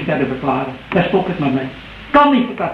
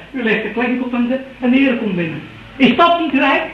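usual speaking voice

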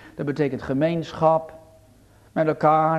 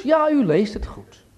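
ordinary talk

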